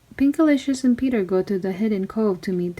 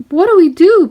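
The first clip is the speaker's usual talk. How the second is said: much higher and louder squeak; panicked squeak; 10 semitones higher, 10 dB louder than usual. Acted. Frightened boy on the pirate ship, high panicked squeak. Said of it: "Peter squeaked".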